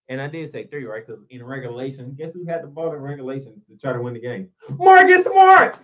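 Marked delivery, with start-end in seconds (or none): 4.8-5.8 high, loud, stretched-out name; exasperated shout. Real, not acted. Fed-up fan shouting who had the ball last.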